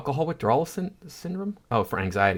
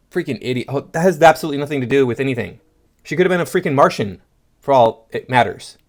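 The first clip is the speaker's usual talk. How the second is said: ordinary delivery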